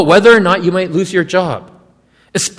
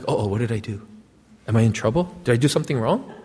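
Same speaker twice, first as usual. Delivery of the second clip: quieter, hushed worry under the breath; worried inner voice; 8 semitones lower, 10 dB quieter, faster than usual. Retold or imitated. Nervous servant fearing the boss's talk, hushed worried voice. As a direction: mutter it like a nervous servant bracing for bad news.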